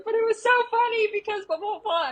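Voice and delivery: very high squealing gush; excited squeal. Retold or imitated. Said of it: "And I was like".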